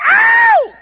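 very high, loud mock yelp; hammed-up falsetto rock yelp; rock star yelp. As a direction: squeal like a rock singer.

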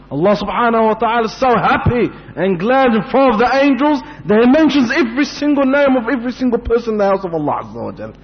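high, swelling sermon delivery; exultant preacher's proclamation; fervent preaching. Real, not acted. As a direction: build excitedly in one long rising sweep. Preacher exalts God's gladness.

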